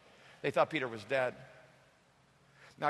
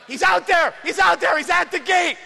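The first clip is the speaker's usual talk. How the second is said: much higher, much louder frantic yelling; excited shout; 12 semitones higher, 10 dB louder than usual. Acted. Preacher as Rhoda shouting to the prayer meeting, frantic. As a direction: burst in shouting the news. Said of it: "I'll be Rhoda".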